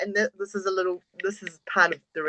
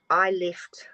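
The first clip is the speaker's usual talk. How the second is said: whispered, choking up; real tearful whisper; near tears. Real, not acted. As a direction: voice failing as tears come. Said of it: "I'm gonna cry".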